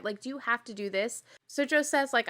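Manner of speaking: usual speaking voice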